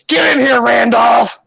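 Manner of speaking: high-pitched shouted order; imitated bossy holler. Patient summons her ex-husband from the door, bossy mock holler. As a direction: holler him inside, imitating the frantic woman.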